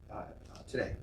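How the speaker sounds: normal talking voice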